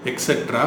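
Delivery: எக்ஸெட்ரா as ek-SET-ra; mispronounced etcetera. Shown as a demonstration. Teacher quoting the common error, mispronounced. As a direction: say எக்ஸெட்ரா with an x sound. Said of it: "அப்போ ஏதோ தப்பு இருக்குல்ல".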